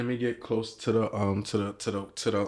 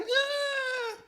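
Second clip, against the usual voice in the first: mock pain cry. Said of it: "She's like".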